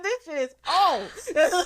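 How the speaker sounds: slow, high, drawn-out mock awe; comic amazement. Retelling the book dealer's verdict, mock amazed. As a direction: stretch it out through laughter. Repeats dealer's reaction amid laughter.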